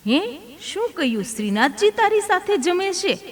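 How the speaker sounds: high, startled disbelief; astonished. Acted. Elder priest startled by the boy's claim, astonished. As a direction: gasp it out as if you can't believe it. Uncle hears Shrinathji eats with boy.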